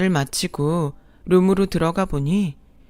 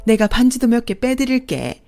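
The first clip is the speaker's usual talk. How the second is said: gloating second mother; 5 semitones higher, 4 dB louder than usual. Acted. Second mother gloating to the wife, sickly sweet. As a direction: gloat with fake generosity.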